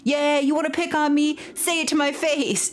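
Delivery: hammed-up brave taunt, pitched high; mock tough guy. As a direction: taunt from safely behind your friends. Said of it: "yelling at the bully and being like".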